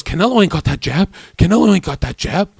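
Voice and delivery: loud, rapid trash talk; hyped-up imitation. Boxer boasting to his boys about a rival, hyped imitation. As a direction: fire it off high and fast, imitating a worked-up boxer.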